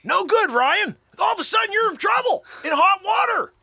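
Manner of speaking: very high, exclaimed comic warning; mock alarm. Host warning guest of trouble, high mock alarm. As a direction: cry out in hammed-up alarm.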